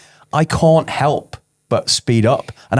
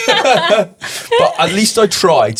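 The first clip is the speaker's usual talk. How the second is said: laughing; 10 semitones higher, much slower than usual. Real, not acted. Laughing self-deprecating admission.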